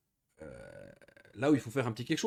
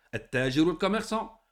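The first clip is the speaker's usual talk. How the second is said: ordinary delivery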